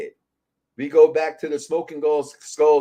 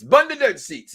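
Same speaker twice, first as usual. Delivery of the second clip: put-on a light Jamaican accent, a bit higher and louder; Jamaican accent imitation; 6 semitones higher, 5 dB louder than usual. Host imitating a fan's catchphrase, in a mock Jamaican accent. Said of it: "There's his Jamaican accent".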